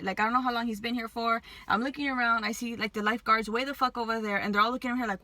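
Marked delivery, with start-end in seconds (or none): none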